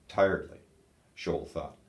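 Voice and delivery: ordinary delivery, nothing marked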